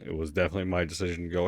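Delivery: usual speaking voice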